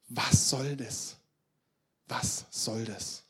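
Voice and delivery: very slow, drawn-out question; despairing question. Acted. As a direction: stretch each word, sinking on the repeat. Acts Elia's despairing question. (verzweifelt gedehnte Frage)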